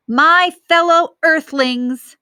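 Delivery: mock the leader grandly; hammed-up impression of the dictator; impersonating Loving Leader. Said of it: "doing my best impersonation".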